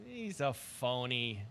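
slow, low, dismissive murmur; quiet put-down. Real, not acted. Host dismissing his former coach, slow and hushed. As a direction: drop it flatly under your breath.

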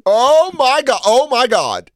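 loud squealing mock delight; over-the-top gushing. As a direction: ham up a lady losing it over a video. Mimicking the laughing lady.

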